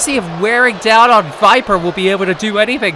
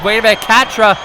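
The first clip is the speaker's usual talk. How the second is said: ordinary talk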